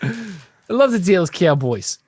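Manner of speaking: drawn-out put-on British accent; mock British accent. Doubling down on the British fan bit, slow mock British accent. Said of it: "That's not how British people talk".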